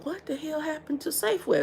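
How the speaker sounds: imitating a friend's high, far-off incredulous voice; lightly voiced buddy asking in disbelief; buddy's disbelief. Buddy Joe back from the store, quoted in mock disbelief. Buddy Joe's reaction, retold.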